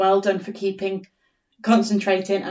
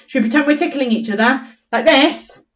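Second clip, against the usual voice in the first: ordinary delivery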